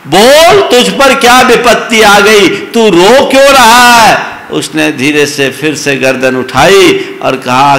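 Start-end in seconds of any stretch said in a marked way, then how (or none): 0.1-4.4 put-on high, loud pleading old woman. Old woman begging the weeping youth on the train, high and loud. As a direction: shake him and beg him to speak. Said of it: "उसे झकझोर के कहा".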